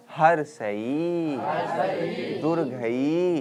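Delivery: हर्षई and दुर्घई with a very long final ई; drawn-out palatal vowels. Shown as a demonstration. Teacher has students feel the palate, long drawn-out ई. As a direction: stretch हर्षई, दुर्घई slowly on the closing ई. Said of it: "तालु में सट रहा है ना जी".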